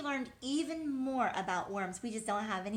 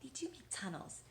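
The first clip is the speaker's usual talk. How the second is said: whispered prompt; 3 semitones higher, 9 dB quieter than usual. Acted. Prompting child's answer.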